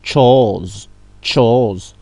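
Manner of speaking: chose said with a TCH at the start; chose with tch, not sh; tch sound. Shown as a demonstration. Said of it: "ça fait cho".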